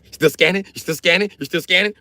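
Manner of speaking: high, loud repeated taunt; taunting shout. Real, not acted. Taunting the AI palm scan, high shouted questions. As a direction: yell the question at the machine.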